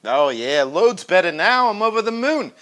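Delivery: ordinary talk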